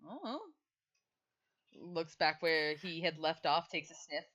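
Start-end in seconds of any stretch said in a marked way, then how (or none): 0.0-0.4 a sudden high little "Oh"; surprised high oh. Acted. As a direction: squeak out "Oh" in surprise.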